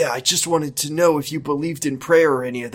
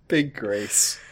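giggling; 6 dB quieter, much slower than usual. Real, not acted. Giggling aside.

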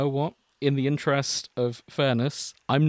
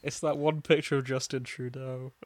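usual speaking voice

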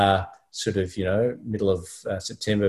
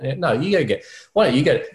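higher, pushy retort in a mock squabble; retold bickering; 6 semitones higher, 4 dB louder than usual. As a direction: toss the chore back at her, half-joking. Retold bickering over fetching halter.

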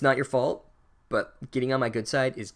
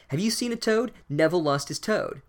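put-on bossy girl; 7 semitones higher than usual. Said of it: "this bossy girl is like".

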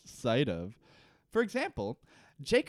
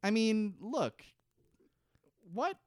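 usual speaking voice

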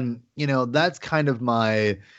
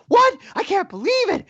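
much higher, hammed-up gasp of disbelief; mock shocked Kaz; 3 dB louder than usual. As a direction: play the hero's cartoon shock, way up high. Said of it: "and Kaz is gonna go".

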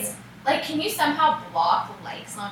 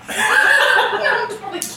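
high, slow, laughing "just kidding"; 10 semitones higher, 4 dB louder, slower than usual. Real, not acted. Laughing admission.

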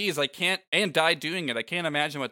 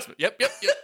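laughing mimicry; 9 semitones higher, 5 dB louder, much faster than usual.

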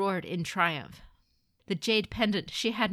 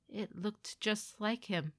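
slow, higher, hushed confession; haunted grief; 4 semitones higher, slower than usual. Acted. Mother doubting what she killed, haunted grief. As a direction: let each word out slowly, full of doubt.

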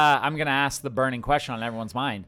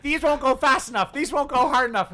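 normal talking voice

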